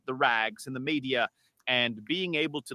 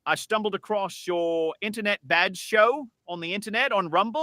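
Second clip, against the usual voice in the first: AI English caller voice; British accent, chatty; 2 semitones higher, 3 dB louder than usual. Acted. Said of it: "I did little accents".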